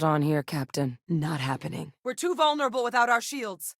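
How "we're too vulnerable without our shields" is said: alarmed warning. Acted. Combat warning in game.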